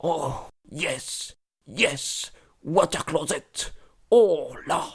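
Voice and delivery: murmuring. Retold or imitated. Englishman talking to himself in a train compartment, soft murmur. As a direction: murmur it softly in separated little bursts. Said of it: "je l'entends murmurer".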